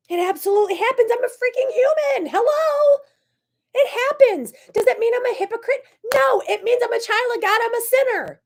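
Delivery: high, emotional, near-crying outburst; all fired up. Real, not acted. Vlogger defending herself to viewers, high and choked. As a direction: cry it out, pitch way up. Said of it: "when I get all riled up and I get loud".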